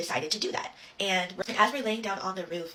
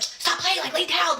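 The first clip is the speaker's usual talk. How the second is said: high, loud imitation of a scolding mom; mom yelling; 11 semitones higher, 7 dB louder than usual. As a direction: yell it like a mom fed up with the racket. Mom yelling at noisy kids, retold.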